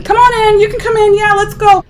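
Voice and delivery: high, upbeat welcome; cheery soldier voice. Retold or imitated. Soldiers welcoming the crowd in, high and upbeat. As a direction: wave them in eagerly.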